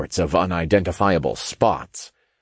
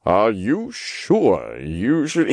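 slow, drawn-out cautioning question; warning; 2 semitones higher, much slower than usual. A narrator's reading. Raphael doubts Jacob should tell all, warning. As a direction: draw out a careful caution. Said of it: "Raphael warned".

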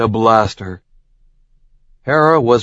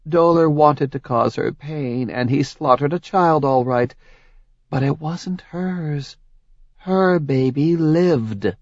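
higher, accusing goddess voice; Hera's voice; 5 semitones higher than usual. Acted. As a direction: lay out his crimes coldly. Hera reveals truth.

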